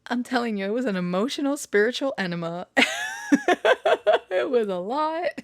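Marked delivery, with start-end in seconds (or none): 2.7-4.2 high squealing burst of laughter; shrieking laugh. Real, not acted. Emotional aftermath, shrieking laugh.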